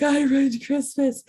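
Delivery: high, slow, crying wail; tearful wail. Retold or imitated. Retelling how she thought she ruined Christmas, high tearful wail. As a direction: let it crack into tears as you say it. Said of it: "And then the tears came".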